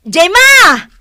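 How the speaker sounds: high, loud call across the yard. Acted. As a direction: shout for her from afar. (umaw-awag a napigsa)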